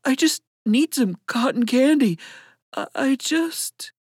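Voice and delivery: high, pleading, faltering at the end; desperation. A narrator's reading. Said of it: "desperation in his voice".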